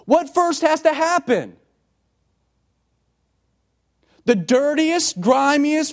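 high, loud preaching at full force; fiery preaching. Acted. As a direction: preach it out to the whole room. Preacher building to a climax.

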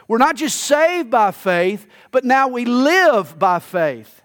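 "live" leaned on slowly, the rest steady; slow down and weigh the word "live"; stress on live. Shown as a demonstration. Preacher to congregation, pressing on "live" slowly. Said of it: "the thrust is on the word live".

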